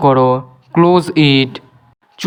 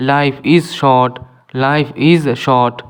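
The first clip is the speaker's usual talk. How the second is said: slow model reading; much slower than usual. Shown as a demonstration. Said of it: "teacher models the sentence slowly".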